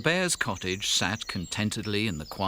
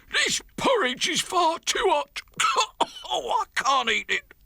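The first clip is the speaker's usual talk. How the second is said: very high, louder comic bear voice; papa bear complaining; 4 dB louder than usual. Acted. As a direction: squawk that the porridge is too hot. Papa bear burnt by porridge.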